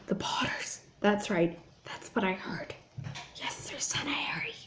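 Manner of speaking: hushed excited whisper. A narrator's reading. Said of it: "were whispering excitedly too".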